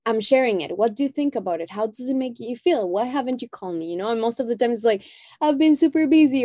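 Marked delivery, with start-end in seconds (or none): 5.4-6.4 higher, offhand excuse; breezy brush-off. Retold or imitated. A mother excusing not calling, breezy and higher. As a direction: toss it off lightly, up high.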